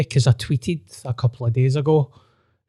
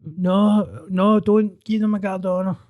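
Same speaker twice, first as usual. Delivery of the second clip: whiny mock fan; 5 semitones higher than usual. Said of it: "so many Celtic fans were like".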